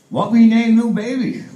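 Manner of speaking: put-on high, loud caricatured Chinese accent; mock Chinese accent. Wife asks husband about their newborn, mock Chinese accent.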